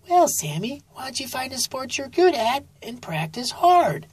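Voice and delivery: high, gentle mom voice; high-pitched mom encouraging her son; mother sloth voice. A narrator's reading.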